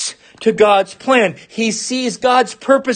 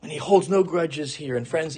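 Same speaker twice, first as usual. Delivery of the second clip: ordinary talk, nothing marked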